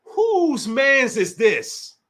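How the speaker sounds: very slow, every word stretched; slow, puzzled question; slow drawn-out ask. Shown as a demonstration. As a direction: draw out "Whose mans is this?" slowly. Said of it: "I said".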